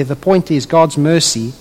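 ordinary delivery

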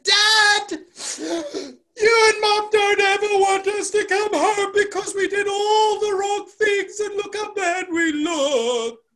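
high, loud, hammed-up child's sobbing; imitating kids crying their hearts out; tearful wailing. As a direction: bawl the confession to Dad, stretching the words. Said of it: "And Nelly was in tears".

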